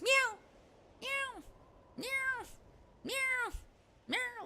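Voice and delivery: high, repeated, insistent little meows; imitated cat meowing. Cat nagging its owner for food, high meows. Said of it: "She goes".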